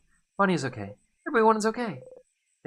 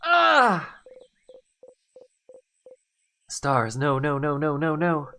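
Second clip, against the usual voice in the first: high gasp then raised frantic denials; shocked gasp; 5 semitones higher, 6 dB louder, slower than usual. Acted. Hero waking after the loop in shock. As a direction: gasp awake in horror.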